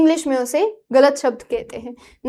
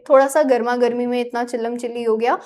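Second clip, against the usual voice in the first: ordinary delivery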